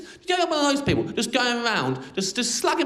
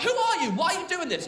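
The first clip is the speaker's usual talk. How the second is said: high, flustered panicky voice, far higher; flustered woman; 9 semitones higher than usual. Retold or imitated. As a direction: imitate a flustered woman caught out. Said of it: "she'll be all flustered".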